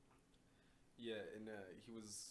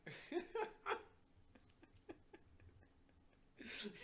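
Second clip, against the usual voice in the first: high unvoiced laugh; 14 semitones higher than usual. Real, not acted. Laughing at prank story.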